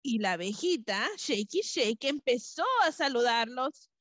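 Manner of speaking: usual speaking voice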